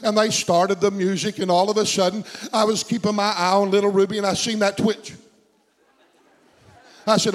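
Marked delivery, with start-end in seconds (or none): none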